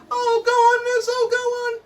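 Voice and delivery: very high pleading whine; whiny kid pleading. Retold or imitated. Whiny pupil pleads with miss, very high whine. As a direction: beg like a little kid. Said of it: "whiny kid".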